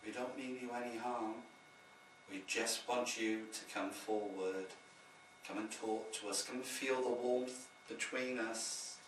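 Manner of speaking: soft, low, gentle invitation; hushed, soothing call to the spirits; dulcet tone. Shown as a demonstration. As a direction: coax a shy spirit forward very quietly. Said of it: "in dulcet tone".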